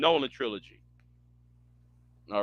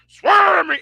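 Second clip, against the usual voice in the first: short, loud, much higher mock yell; Batman shout; 12 semitones higher, 10 dB louder than usual. Streamer mocks Bale's Batman, loud high yell. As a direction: belt it like an overdone movie hero. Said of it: "what Bale's Batman was saying".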